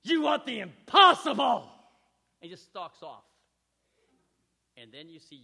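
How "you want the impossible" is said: loud, slow, higher angry outburst; frustrated shout. Retold or imitated. Luke yells at Yoda before stalking off, angry. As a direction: hurl it at the teacher in frustration. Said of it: "and Luke goes".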